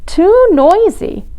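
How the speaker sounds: high, slow drawn-out complaint; upset baby penguin. A narrator's reading. Baby Peter objects to noise, upset wail. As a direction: wail the protest slowly.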